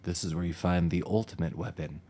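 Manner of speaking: low, soft game-hint voice; mock in-game guide; video game voice. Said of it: "like a video game".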